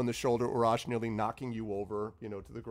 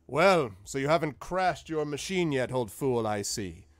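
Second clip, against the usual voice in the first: gruff giant teasing; 3 semitones higher, 6 dB louder than usual. Acted. Harshnag teasing old friend.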